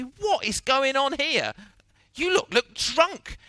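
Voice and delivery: high, slow, scoffing crowd voice; mocking bystanders. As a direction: sneer it like a doubtful onlooker.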